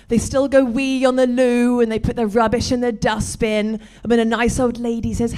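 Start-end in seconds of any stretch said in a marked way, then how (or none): none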